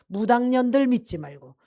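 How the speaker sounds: 무당년들 punched out loud, furious warning; angry dying father. Retold or imitated. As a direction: shout down the shamans' name. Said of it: "뭐라 그러시냐면". (아버지의 화난 외침)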